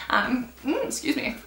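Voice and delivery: stifled burp, quiet. Real, not acted. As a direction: cover a burp. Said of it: "because burping mango".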